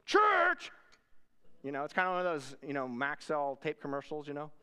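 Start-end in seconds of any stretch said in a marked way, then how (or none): 0.0-0.7 high, explosive mock roar; worked-up outburst. Big angry man filling the doorway at visitors, imitated outraged roar. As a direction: roar the one word like a swelling giant. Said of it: "gets bigger and bigger and bigger".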